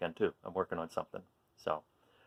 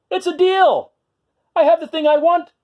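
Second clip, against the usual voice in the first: high, louder excited squeal; 14 semitones higher, 7 dB louder than usual. Acted. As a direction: squeal with delight. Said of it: "he squealed".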